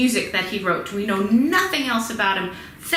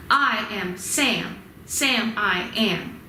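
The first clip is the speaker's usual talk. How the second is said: slow chant, Sam and am stressed; slow chanted poetic meter; strong weak strong rhythm; much slower than usual. Shown as a demonstration. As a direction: beat out the meter slowly. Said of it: "stressed or long syllable, followed by an unstressed".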